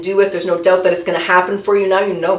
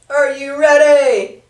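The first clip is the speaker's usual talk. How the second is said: slow and drawn out, higher; teasing; 6 semitones higher, much slower than usual. Real, not acted. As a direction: tease it like a game-show host. Teasing before card reveal.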